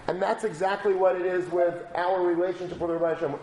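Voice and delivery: ordinary delivery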